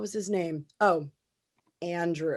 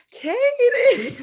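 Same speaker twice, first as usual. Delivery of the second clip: high, loud hype-up yell; mimicked cheer; 8 dB louder than usual. Host mimics the men in the limo, mock cheering yell. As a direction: imitate a crowd whooping a name.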